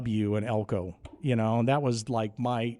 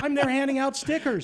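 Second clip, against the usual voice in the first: very high, loud cry of disbelief; panicked protest; 13 semitones higher, 4 dB louder than usual. Retold or imitated. Teenage intern sent to face a stadium crowd, panicked protest. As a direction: wail it, pleading your youth.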